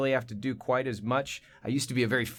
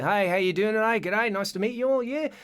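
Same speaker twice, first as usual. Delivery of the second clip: higher-pitched, cheery Australian greeting; Australian accent; 8 semitones higher than usual. Acted. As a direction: greet the room as a put-on Australian. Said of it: "I'm doing the Australian thing".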